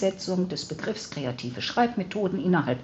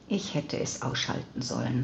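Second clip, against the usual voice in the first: sighed out on the breath, nearly voiceless; 5 semitones lower, 4 dB quieter than usual. A narrator's reading. Veronika groans as the phone rings again, sighing. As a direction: let the words fall out as a tired sigh. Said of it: "seufzte sie".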